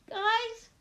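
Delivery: high, pleading call. Acted. Trapped girl to unseen girls, high call. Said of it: "I call".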